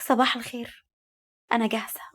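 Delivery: soft, gentle greeting; calm, smiling. A narrator's reading. Woman telling him she is ready, calm and smiling. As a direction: greet him quietly with a slight smile. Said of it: "وابتسمت بهدوء وقالت".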